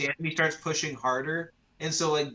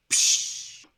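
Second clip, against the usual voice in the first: hissed "psh" cymbal splash; cymbal crash; 3 dB louder than usual. Acted. Game master adds the clasp of cymbals, hissed crash. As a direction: imitate cymbals with a burst of breath. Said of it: "like, the cymbals".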